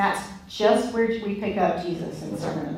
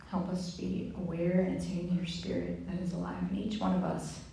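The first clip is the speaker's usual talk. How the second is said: soft, hushed prayer voice; quiet prayer; 9 dB quieter than usual. Acted. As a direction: pray gently and quietly. Prays quietly for the group.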